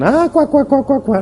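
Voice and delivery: high, squawking mock laughter; mocking coworkers. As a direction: imitate the Greeks jeering at his English. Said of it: "Y me decían".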